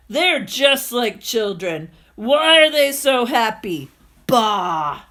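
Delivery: put-on loud, slow, sour old landlord voice; grumbling old miser. The landlord sneering at dancing villagers, loud and grumpy. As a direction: grouse about them like a bitter old man. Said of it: "he mumbled and grumbled".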